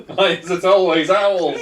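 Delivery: put-upon, half-laughing cry; mock wail. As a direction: lament it in comic despair. Character pecked by an owl.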